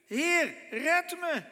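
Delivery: high, loud, slow cry for help; desperate cry. A narrator's reading. Peter sinking in the waves crying to Jesus, desperate. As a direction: call out as if sinking. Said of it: "schreeuwde het uit".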